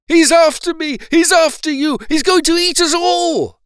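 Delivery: high, loud panicked cries; terrified dentist yelling that the crocodile will eat them; panicked shouting. A narrator's reading. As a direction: yell it in terror. Dentist panicking, climbing the wall.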